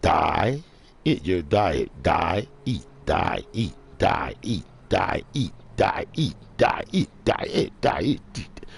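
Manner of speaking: "Die, eat" chopped into two stressed beats, over and over; syllable by syllable. Shown as a demonstration. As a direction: drill "Die, eat" like a repeated chant. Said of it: "Phonetic syllables?".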